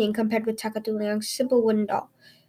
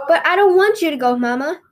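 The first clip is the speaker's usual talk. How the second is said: put-on higher, louder childlike plea; pleading little girl; 5 semitones higher, 5 dB louder than usual. A narrator's reading. Little girl begging her dying mother not to leave, pleading. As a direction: beg like a small child who won't let go.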